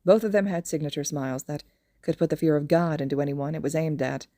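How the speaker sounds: ordinary delivery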